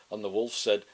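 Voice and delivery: plain reading voice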